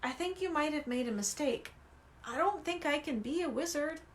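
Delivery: hushed, hesitant protest; said quietly. A narrator's reading. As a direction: quietly admit your doubt. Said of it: "he said quietly".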